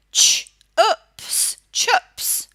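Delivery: chups sounded out, Ch- then ups, then blended; sounding out words. Shown as a demonstration. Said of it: "I'm going to sound out the word chups".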